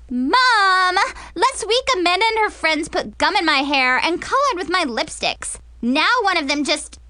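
high, loud tattling whine; screeching girl. Acted. Girl tattles to Mom about gum and lipsticks, screeching. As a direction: screech a complaint to a parent. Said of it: "Mackenzie's screeches".

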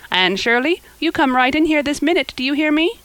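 put-on cross call to a dawdling child; stern calling out. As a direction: order a child indoors sharply. Marilla calls Anne indoors crossly.

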